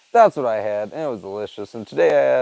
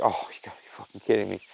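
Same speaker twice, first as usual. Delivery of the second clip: exasperated mutter; 6 semitones lower, 9 dB quieter than usual. Retold or imitated. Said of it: "I was like".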